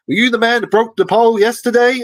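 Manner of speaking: high, loud American guard voice; accusing guard. Retold or imitated. American guard accusing him of breaking the pole, high and loud. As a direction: bark the question up high like a suspicious guard.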